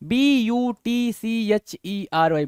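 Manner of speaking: each letter spaced, slowly; slow spelling out. Shown as a demonstration. Teacher spelling butchery for the class, slowly letter by letter. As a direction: dictate the letters one at a time. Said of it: "बूचरी बोलते हैं".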